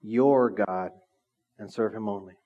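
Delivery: pause, then lean on "your" in "your God"; pause for emphasis. Shown as a demonstration. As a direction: set "your God" apart after a pause. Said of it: "let me just pause for emphasis".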